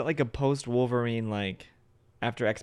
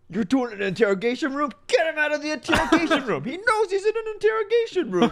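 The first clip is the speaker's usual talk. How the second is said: incredulous mock quote; 5 dB louder than usual. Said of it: "His first step is".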